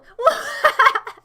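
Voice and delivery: loud, high burst of laughter. Real, not acted. Streamer cracks up after defending herself, loud laugh. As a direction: break into a big high laugh.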